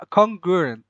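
congruent slow and high, with a full CON; failed pronunciation attempt. Real, not acted. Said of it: "I, I can't".

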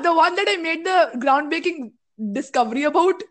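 usual speaking voice

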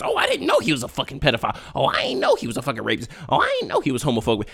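fast, soft, put-on voice of a shocked acquaintance; mock surprised bystander. Mocking people shocked by a friend's secret. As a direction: rattle off fake shock. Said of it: "That's why people are so surprised".

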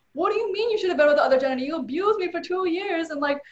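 replaying the fury at the ex's friends; retold outrage. Reliving anger at the ex's friends, fast high outraged rant. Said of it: "And I was, I was pissed".

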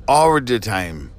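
low, slow "All" with an R ending; L turned to R. Shown as a demonstration. Host mocking mixed-up words, "All" said with R. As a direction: repeat "All" as "or", slowly. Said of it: "are L's R's".

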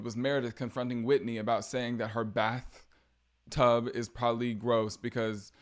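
ordinary talk

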